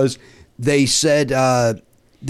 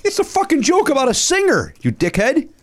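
exasperated rant; 14 semitones higher than usual. Real, not acted. Exasperated outburst at fans.

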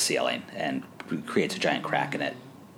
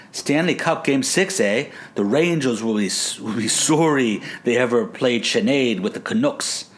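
put-on Canadian accent; 4 semitones higher, 3 dB louder than usual. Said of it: "in a heavy Canadian accent".

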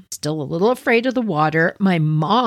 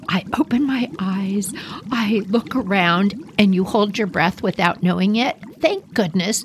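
usual speaking voice

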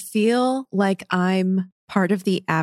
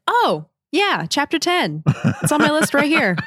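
higher, breezy offhand voice; careless production crew; 7 semitones higher than usual. Retold or imitated. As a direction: tick it off without a care.

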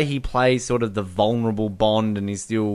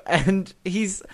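usual speaking voice

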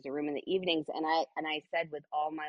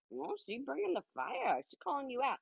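a hint of a sassy onlooker, pitched up; mock critic; 6 semitones higher than usual. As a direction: lightly tease like a skeptical audience member. Voicing an imagined critic.

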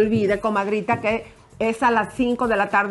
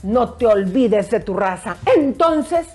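dramatic recitation; 7 semitones higher than usual. Pretend. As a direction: declaim with passion, rising high. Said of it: "Y dice así".

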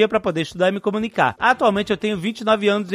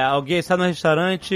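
ordinary delivery

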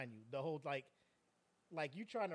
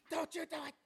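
high, strained commentator pleading; hammed-up outrage with a loud burst midway; Jim Ross; 11 semitones higher, 3 dB louder than usual. Announcer begs an attacker to stop, mock anguish. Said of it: "Jim Ross".

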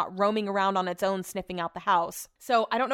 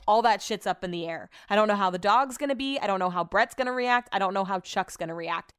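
ordinary talk, nothing marked

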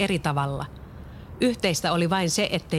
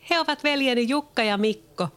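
put-on higher girl's voice, amused; laughing girl; 8 semitones higher than usual. Leena introduces her brothers to Peter, amused higher girl's voice. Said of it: "Leena nauroi".

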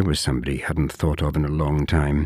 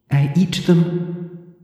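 flatly; 13 semitones higher, 4 dB louder, much slower than usual. Acted. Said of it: "she said flatly".

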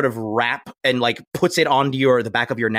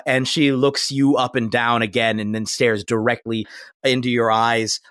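normal talking voice

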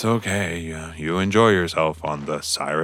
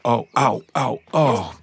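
sharp little ows; pained yelp; 6 semitones higher, 5 dB louder than usual. Real, not acted. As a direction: yelp as claws grip you. Bird lands on shoulder.

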